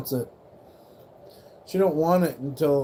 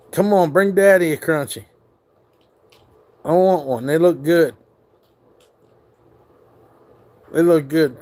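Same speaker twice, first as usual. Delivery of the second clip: loud playful begging; 3 semitones higher, 7 dB louder than usual. Real, not acted. Loudly begging the dog.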